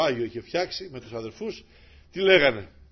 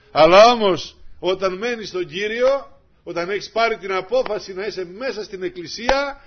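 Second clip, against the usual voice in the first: higher, louder, slow sermon cry; full-force preaching; 6 semitones higher, 11 dB louder than usual. Acted. (κήρυγμα με πάθος)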